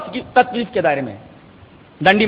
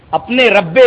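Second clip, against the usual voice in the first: ordinary delivery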